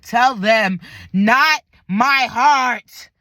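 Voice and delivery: loud, slow, dragged-out plea; a patient crying out to the doctors to spare her heart; desperate plea. Retold or imitated. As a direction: beg them loudly, stretching each word. Begging half-conscious on operating table.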